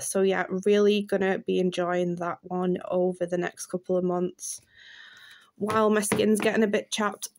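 ordinary talk, nothing marked

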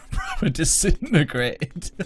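laughing through the words; amused, slow laughing line. Real, not acted. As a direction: giggle it out slowly. Laughing at friend's death in game.

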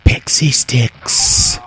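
slow breathy whisper; whispered aside. Real, not acted. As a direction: whisper it under your breath. Odd aside, unvoiced.

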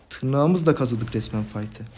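ordinary delivery

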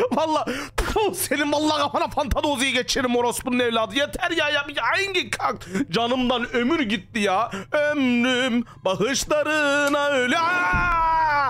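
high-pitched, shaken ranting; slowing, high, spooked complaining; frightened rant. Real, not acted. Still panicked after the scare.

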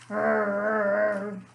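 low drawn-out growl; bear growl. Acted. Mysterious sound over the windowsill, low bear growl. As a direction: rumble it deep from the belly. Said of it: "Grrr rumbled from the bear's belly".

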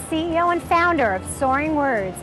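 normal talking voice

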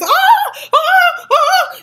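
high, loud panicked ah-ah-ahs; retold screaming. Running in circles from the cops, panicked shrieks. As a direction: shriek it out in short bursts of panic. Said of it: "I scream and I'm running".

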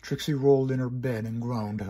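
usual speaking voice

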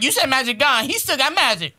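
high, indignant imitation; mock protest. Catching dad out on the elf's magic, mock protest. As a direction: argue back like someone caught out on a rule. Parent retelling kid's complaint.

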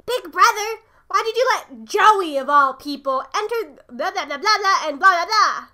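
put-on loud kid protesting to big brother; loud complaint. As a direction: demand an explanation, loudly.

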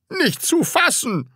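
high-pitched outrage; indignant scolding. Acted. Teacher called a woman by pupil, scolding indignantly. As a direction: splutter with indignation. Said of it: "schimpfte Herr Groll".

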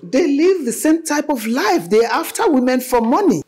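high, loud, emphatic accusation; heated insistence. Acted. Mother accuses the boyfriend of chasing money, heated. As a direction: hammer the accusation home, exasperated.

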